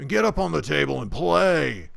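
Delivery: loud, booming orc order; orc's command. Acted. Orc ordering the frightened girl to play, loud. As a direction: boom it out like a big orc. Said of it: "And he says".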